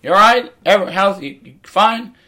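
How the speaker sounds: high, loud, over-eager host voice; mocking chirpy comic. Mocking other comics' over-eager crowd greeting. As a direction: ham up the cheesy greeting. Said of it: "the first thing they'd all said".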